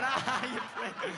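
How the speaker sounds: laughing insult. Retold or imitated. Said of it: "It's going".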